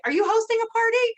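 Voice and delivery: pushy sales ask. Shown as a demonstration. Rep showing other reps a pushy party ask. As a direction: blurt the sales pitch too eagerly. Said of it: "So I didn't say".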